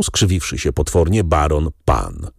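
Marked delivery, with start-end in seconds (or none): none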